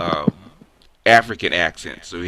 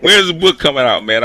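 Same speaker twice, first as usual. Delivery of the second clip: higher and louder, laughing through the tease; teasing shout; 5 semitones higher, 10 dB louder than usual. Real, not acted. Host ribbing a guest about his unreleased book, louder teasing. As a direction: push it again, playfully insistent.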